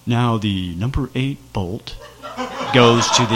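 low, mumbled lecture voice; lightly voiced meek instructor; meek mumbling instructor. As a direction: mimic a timid man droning without a mic. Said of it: "this meek man without a mic going".

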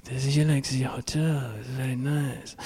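soft, lightly voiced French Canadian saleswoman; French Canadian accent. Saleswoman Valerie showing a luxury hotel, soft French Canadian accent. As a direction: sell it softly with a Montreal French accent. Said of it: "French Canadian from Montreal".

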